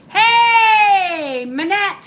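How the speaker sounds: high, loud cheerful hey; loud greeting shout. Real, not acted. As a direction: call out a hello to a viewer. Greeting a live viewer.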